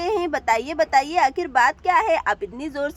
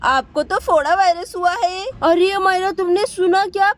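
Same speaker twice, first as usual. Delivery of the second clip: usual speaking voice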